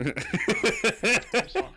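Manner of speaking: slow, loud I'm sorry; loud drawn-out apology. Real, not acted. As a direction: call it out, stretched and loud. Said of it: "Is that better?".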